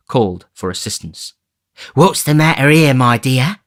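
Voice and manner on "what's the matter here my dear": put-on high, loud old man's call.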